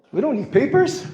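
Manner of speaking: ordinary talk, nothing marked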